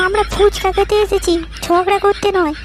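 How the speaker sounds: very high-pitched scolding wife voice; exasperated wife. Acted. Wife tells husband they came to eat, exasperated. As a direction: scold him in a high, put-on voice.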